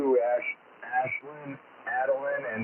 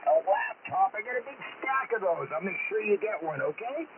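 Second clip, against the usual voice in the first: put-on higher, hearty Santa Claus with lots of oh boys; jolly Santa voice; 10 semitones higher than usual. Santa on the radio greeting kids, jolly and hearty. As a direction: play a cheerful Santa over the radio to excited kids.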